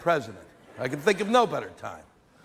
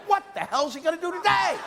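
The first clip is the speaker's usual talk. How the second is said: panicked yell; 14 semitones higher than usual. Retold or imitated. Comic recalling Reagan fears, high mock panicked shout. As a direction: voice shoots much higher in mock alarm. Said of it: "he'd wake up and go".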